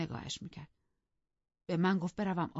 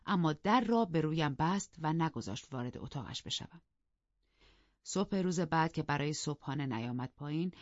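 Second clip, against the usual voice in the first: ordinary delivery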